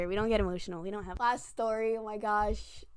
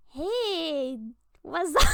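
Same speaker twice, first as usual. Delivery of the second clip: mimicked boy greeting; 6 semitones higher, 6 dB louder, much slower than usual. Imitates boy's entrance greeting.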